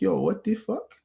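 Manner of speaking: high, stunned yo; learning the truth a year later, shocked. Retold or imitated. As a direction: sound floored by the news. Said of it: "I'm like".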